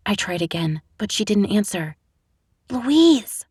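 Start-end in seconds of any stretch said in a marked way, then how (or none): 2.7-3.5 louder, higher urgent call. Acted. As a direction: raise your voice to reach her. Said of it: "I called a little louder".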